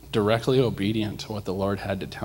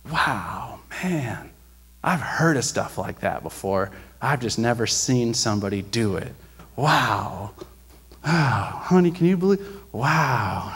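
amazed man; 2 semitones higher, slower than usual. Retold or imitated. The man behind her in line telling his wife, lightly voiced amazement. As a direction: lightly voice a stunned stranger. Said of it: "he's just like".

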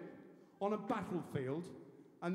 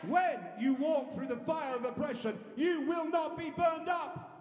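rising shouted preaching, higher and louder; preaching at full force; 5 semitones higher, 4 dB louder, much slower than usual. Real, not acted. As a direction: build to a shout on the flames. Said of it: "Let's hear it then".

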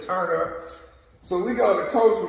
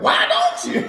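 cheeky friend; 4 dB louder than usual. Retold or imitated. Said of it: "My boy like".

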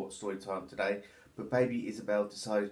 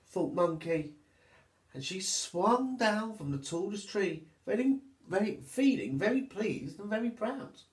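put-on lively, sing-song reading for little children; storybook narration; 7 semitones higher than usual. A narrator's reading. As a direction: read it like storytime for toddlers.

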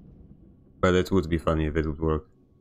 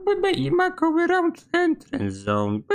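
very high, slow squeaky mock voice; a shrill cartoon parody of the guard; mocking impression; slower than usual. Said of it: "That's how you sound".

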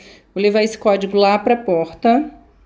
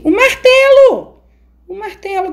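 excited squeal; 11 semitones higher, 6 dB louder than usual. Real, not acted. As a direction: squeal at finding the prize. Finds the hammer, excited. (grito empolgado e agudo)

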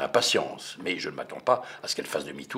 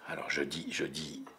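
ordinary delivery, nothing marked

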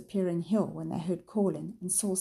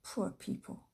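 pitying murmur; 7 dB quieter than usual. A narrator's reading. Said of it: "Jane gave a shudder".

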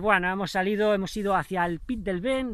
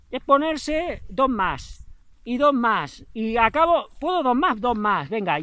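louder, rising push, egging himself on for two more; pumped-up self pep talk; 4 semitones higher, 6 dB louder, slower than usual. Retold or imitated. Runner urging himself onward for two more series, louder and pumped. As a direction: drive yourself on, one more rep.

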